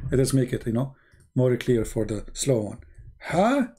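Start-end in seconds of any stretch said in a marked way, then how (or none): 3.2-3.7 single high, mocking "ha"; mock devil laugh. Shown as a demonstration. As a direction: demonstrate Satan's laugh on one high syllable. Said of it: "he's laughing like".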